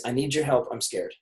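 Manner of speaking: dropping to a hush; a softly voiced scared client; soft confession. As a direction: admit fear quietly. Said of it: "what they're really saying is".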